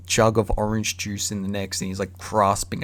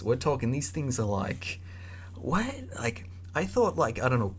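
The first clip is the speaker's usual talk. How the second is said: normal talking voice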